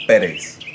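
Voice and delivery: Pérez with the first syllable PÉ stressed; Spanish Pérez, stressed on PÉ. Shown as a demonstration. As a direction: hit the PÉ in Pérez, let the rest fall. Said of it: "stress is on that first syllable".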